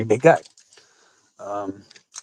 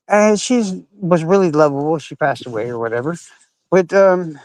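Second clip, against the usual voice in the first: normal talking voice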